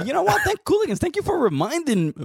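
hammed-up, high-pitched thanks to the Cooligans; gushing mock thanks. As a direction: gush your thanks upward.

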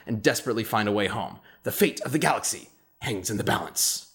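booming trailer announcer voice; grand booming space narration. Acted. Sponsor ad read in announcer voice.

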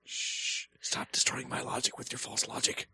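hushed mock scolding; whispering. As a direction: whisper an indignant rebuke. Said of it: "Why are we whispering?".

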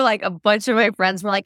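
ordinary talk, nothing marked

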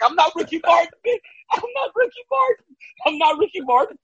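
high-pitched comic protest; mock protest. Host recalls fretting over open shirt, high mock protest. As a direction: squeal it lightly, amused at yourself. Acts out panicked self-talk, laughing.

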